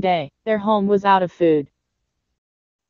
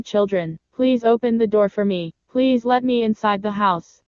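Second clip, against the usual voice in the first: plain reading voice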